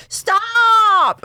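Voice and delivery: high, loud shriek; shrieking stop. Retold or imitated. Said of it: "And she goes".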